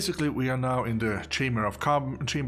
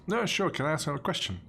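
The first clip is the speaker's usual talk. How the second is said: normal talking voice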